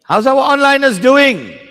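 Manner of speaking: loud, bright greeting to the online class; loud call-out. Real, not acted. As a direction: call out to the students watching online. Calling out to online students.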